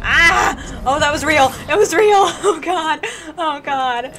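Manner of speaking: loud high panicked yelling; startled, high, full-volume outburst; real panic. Real, not acted. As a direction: yelp in shock as it turns real. Startled in a game fight.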